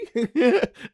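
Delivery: high, loud burst of laughter. Real, not acted. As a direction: laugh out at the huge pour. Laughing at the pour.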